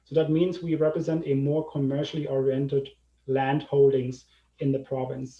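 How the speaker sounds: ordinary talk, nothing marked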